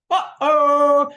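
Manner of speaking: very high, loud, hammed-up gasp of doom; mock dramatic uh-oh. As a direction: play it like a soap opera sting. Reacting to bride's no.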